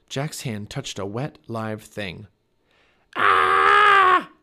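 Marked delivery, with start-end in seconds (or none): 3.2-4.3 loud, high scream; long, piercing cry of alarm. A narrator's reading. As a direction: scream in sudden fright. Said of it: "he cried".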